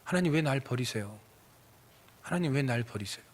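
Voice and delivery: soft, subdued plea to God; forsaken believer's lament. Acted. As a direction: ask God quietly, as one abandoned. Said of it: "그게 바로 우리의 고백이라는 거예요".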